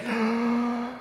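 sharp gasp of panic; anxious gasp. Retold or imitated. Gasping at thirty-eight dinner guests. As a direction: suck in breath like anxiety hits. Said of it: "the anxiety is just like".

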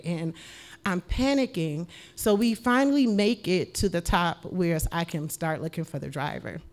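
normal talking voice

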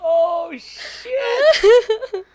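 high squeaky laugh-crying; words squeezed out through laughter; laughing hard. Real, not acted. Laughing helplessly at the memory.

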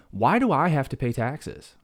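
rising outrage over taxes, slowed for effect; fired up. Real, not acted. Teacher ranting to listeners about paying taxes, fired up. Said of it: "I'm getting fired up".

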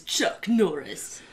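booming slow announcer hype; monster truck ad. Pretend. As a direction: shout it like an arena promo.

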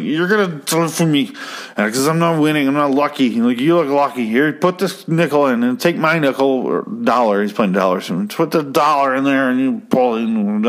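put-on high slurry drunk; drunk guy voice. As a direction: ham up a sloppy drunk asking a favour. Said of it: "doesn't sound like Cosby like that".

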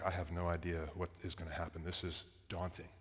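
very soft, low recalled thought; daunted, hushed. Retold or imitated. Preacher recalls facing the city downtown, hushed and daunted. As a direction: drop low and quiet, as if thinking aloud.

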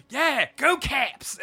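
high, loud, drawn-out Baltimore cheer; mock Baltimore fan yell, vowels stretched; thick Baltimore accent. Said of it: "a thick Baltimore accent".

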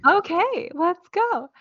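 peppy high rally cry; cheerleader. Acted. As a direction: pump up the crowd. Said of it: "the time when I sound like a cheerleader".

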